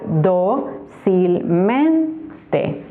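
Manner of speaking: "dócilmente" slowly split into do, cil, men, te; Silbentrennung. Shown as a demonstration. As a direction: separate each syllable of "dócilmente". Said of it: "lo vamos a separar".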